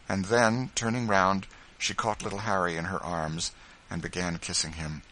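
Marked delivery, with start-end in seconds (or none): none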